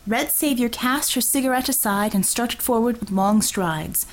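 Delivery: ordinary talk, nothing marked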